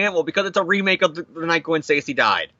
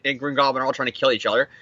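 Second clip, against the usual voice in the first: normal talking voice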